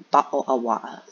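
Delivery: loud, exaggerated British accent; mock British accent. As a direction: put on a punchy British accent. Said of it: "I'd rather speak British than Canadian".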